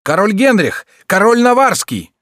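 very high, loud sentry shout. A narrator's reading. Sentries telling the queen who flees, shouting. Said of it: "кричали часовые".

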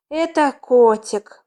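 slow, breathed-out announcement of wonder; exhaled exclamation. A narrator's reading. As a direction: let it out on a sigh of delight. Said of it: "выдохнула гречишница". (на выдохе, с восторгом)

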